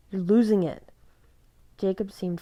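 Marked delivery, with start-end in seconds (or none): 0.1-0.9 louder, pressing warning; urgent warning. A narrator's reading. Seth warns Jacob he's losing control. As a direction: snap him out of it.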